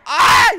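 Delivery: short loud high yelp; panicked scream. Real, not acted. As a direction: scream as you go down. Crash in the game.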